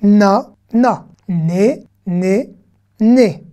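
na, né, nou, no, ni read out loud one by one; reading syllables aloud. Shown as a demonstration. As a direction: call each syllable out clearly for the class. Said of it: "ce que nous allons lire tout de suite".

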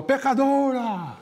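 high, drawn-out call from the crowd; imitated shouted request. Fan yelling a request to the singer, imitated shout. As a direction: call out the song title high and long. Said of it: "Um gritou lá".

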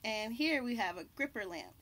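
usual speaking voice

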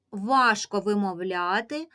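normal talking voice

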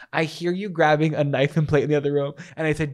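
ordinary talk

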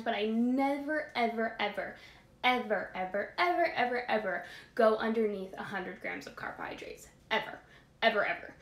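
stressing never, ever, ever over and over; emphatic repeated ever. Real, not acted. To listeners about carbs, insistently stressing every ever. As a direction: drive home each ever, piling them up. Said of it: "I love to, like, put emphasis on that".

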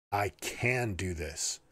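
slow mantra with the weight on "can"; stress on CAN. Shown as a demonstration. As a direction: press on "can" like a promise. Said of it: "inflect on each of the different words".

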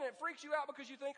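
ordinary talk, nothing marked

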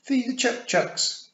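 put-on high, slow, loud toddler voice; piping toddler repeating his chore; little boy. A narrator's reading. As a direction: answer like a proud small child. Small boy answers his sister.

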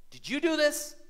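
sharp high accusing question; accusing parent. Retold or imitated. Parent confronting a guilty child, sharp and high. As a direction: catch the kid red-handed.

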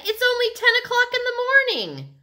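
high, loud, fast protest; indignant outburst. A narrator's reading. Libby protests the early hour to Mitzi, indignant. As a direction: rush it out in exasperation.

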